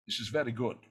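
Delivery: low, soft impression of a film legend; deep, understated impersonation; Sean Connery. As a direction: murmur approval as the Scottish star.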